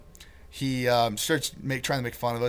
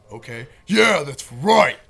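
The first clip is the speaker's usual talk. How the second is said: louder, put-on tough-guy voice; hammed-up tough guy; 3 semitones higher, 12 dB louder, slower than usual. Teller mimics the road worker boasting at the band, louder and put-on. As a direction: puff up like the angry worker. Retold worker, loud and far.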